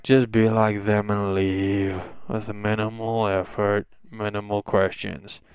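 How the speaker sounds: slow, soft, weary drone; weary apathy. Acted. Teacher telling students to just leave, wearily. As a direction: let it drag out with minimal effort. Bored teacher wants minimal effort.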